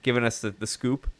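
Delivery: usual speaking voice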